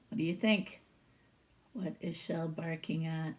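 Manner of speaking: usual speaking voice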